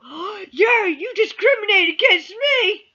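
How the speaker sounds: high, loud mock outrage; hammed-up indignant whine; mocking gasp. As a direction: parody an offended person gasping. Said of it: "Don't gasp and go".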